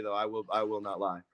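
ordinary delivery

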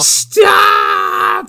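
high, drawn-out yell of alarm; mock shout. Calling back an old show joke, mock shout. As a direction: cry out in hammed-up panic.

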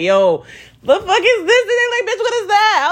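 shocked exclamation. Retold or imitated. Homegirls trading shock over the bug, high-pitched disbelief. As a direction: pitch it up high, half laughing. Texts with friends about the bug.

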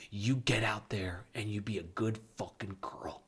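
low-volume, menacing mock command; stern hushed order. Husband pushing wife onto TV, hushed mock threat. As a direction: order her under your breath.

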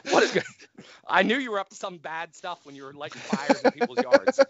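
high, loud playful ribbing; teasing. Real, not acted. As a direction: rib him with a laugh. Teasing friend about bad stuff.

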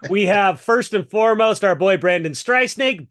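announcer hype; big excited introduction; hype intro. Real, not acted. Introducing guest.

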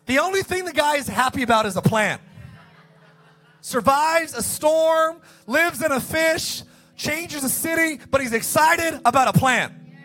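mock amazement. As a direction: ham up the disbelief at Jonah. Mocking Jonah's excitement over plan.

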